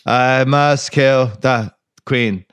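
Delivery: slow, hypnotized killer monotone; Reggie Jackson. Retold or imitated. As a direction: intone it like a programmed assassin. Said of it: "is just Reggie Jackson".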